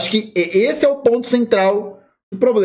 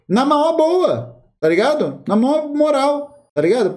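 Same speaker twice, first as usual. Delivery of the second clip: laid-back; 6 semitones higher than usual. Acted. Friendly street slang.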